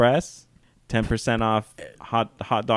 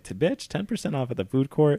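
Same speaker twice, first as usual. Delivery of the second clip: higher, hyped-up bragging; retold excitement; 6 semitones higher than usual. Mall worker bragging about a tiny discount, excited. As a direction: brag as if it were a big deal.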